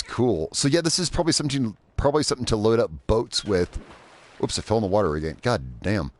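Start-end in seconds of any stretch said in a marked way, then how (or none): none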